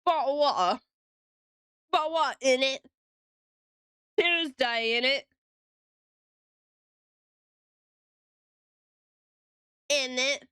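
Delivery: light mock British accent with glottal T's. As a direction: tease a London accent, bottle of water and in it. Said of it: "I'm British in it".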